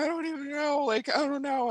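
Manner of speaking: retold high, helpless protest; helpless confusion. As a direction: plead that you're at a loss. Retold tearful struggle to answer practitioner.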